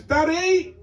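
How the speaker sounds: put-on high-pitched child calling Daddy; imitating a child, high and insistent; young son. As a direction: pipe up like a kid calling from another room. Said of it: "He goes".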